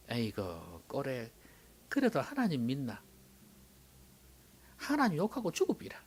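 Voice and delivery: wife's quiet scolding in Gyeongsang dialect. Shown as a demonstration. Job's wife tells him to give up on God, Gyeongsang dialect. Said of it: "이제 경상도 버전으로 한번 해볼까요?".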